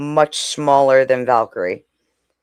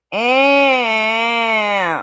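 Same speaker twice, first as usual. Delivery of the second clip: cat grunt impression; 7 semitones higher than usual. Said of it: "She'd be like".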